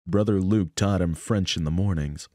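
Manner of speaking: usual speaking voice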